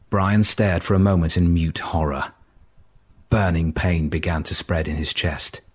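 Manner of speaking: ordinary delivery, nothing marked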